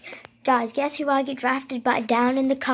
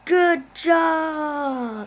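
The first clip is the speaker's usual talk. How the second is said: cheering shout; 5 semitones higher, 7 dB louder than usual. Real, not acted. Cheering at game win.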